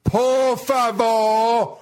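very slow, high, over-enunciated; talking to a foreigner. Acted. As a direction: slowly spell it out for a tourist. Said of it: "speak slowly and loudly".

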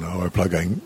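usual speaking voice

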